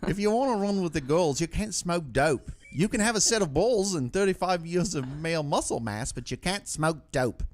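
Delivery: badly faked Kiwi accent, drawing out the punchline; mock New Zealander laying down the law; attempted New Zealand accent. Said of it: "in a New Zealand accent".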